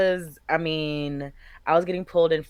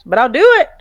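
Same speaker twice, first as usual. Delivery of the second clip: loud, higher eager promise; 8 semitones higher, 14 dB louder, faster than usual. Real, not acted. To her cohost, eagerly volunteering, loud and up. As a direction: jump in loudly, all for it.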